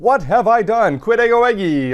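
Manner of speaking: "What have I done? Quid ego egi?" pitched high, with "ego" emphasised; emphatic exclamation. Acted. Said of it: "The ego is the, um, em-, emphatic".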